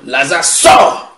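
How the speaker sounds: high, drawn-out commanding shout; forceful summons, second word soaring; loud cry. Acted. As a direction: call him out at full voice. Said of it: "il cria d'une voix".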